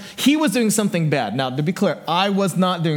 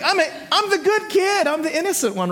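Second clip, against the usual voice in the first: put-on high, pleading little-kid voice; protesting kid; 11 semitones higher than usual. Younger son defending himself to mom, mock-innocent. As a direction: insist on innocence, pitched way up. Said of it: "I'm like".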